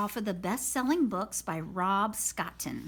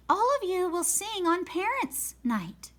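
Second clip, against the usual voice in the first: lightly voiced teacher; 8 semitones higher than usual. Teacher voiced in read-aloud.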